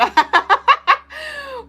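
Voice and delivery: sudden high squealing laugh; big real laugh; high cackle. Real, not acted. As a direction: burst into a shrill-free high laugh. Laughing at comment.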